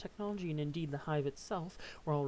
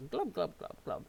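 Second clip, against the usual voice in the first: gurgling fish man croak. Acted. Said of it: "I can't really control the glubs".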